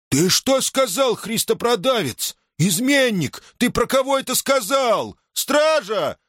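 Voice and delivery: очень высокий, истошный обвинительный крик; кричи высоко, обличая изменника и зовя стражу; возмущённый крик. Acted. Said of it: "Да не ори ты". (возмущённо, криком на высокой ноте)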